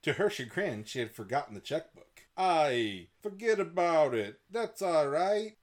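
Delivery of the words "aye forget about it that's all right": put-on mechanic, pitched high; slow, high cartoonish mechanic; mechanic character voice. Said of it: "the mechanic said".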